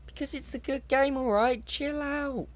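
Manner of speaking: squeaky high snappy comeback; high-pitched sassy reply; high mock retort. Retort to imagined viewers.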